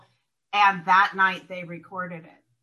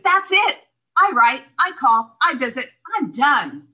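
higher, louder, firm and final; emphatic declaration; 7 semitones higher, 7 dB louder than usual. Retold or imitated. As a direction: lay it down like you're finished for good. Retelling firm decision to sponsor.

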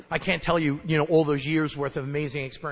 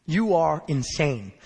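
blunt sponsor; 2 semitones lower, much slower than usual. Retold or imitated. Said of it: "he just said".

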